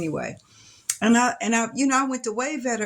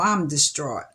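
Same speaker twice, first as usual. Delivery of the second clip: ordinary delivery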